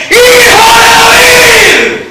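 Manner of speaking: slow, high, loud desperate plea. Acted. Blind beggar shouting again over the crowd, desperate plea. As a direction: cry out again, even harder. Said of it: "él vuelve a gritar".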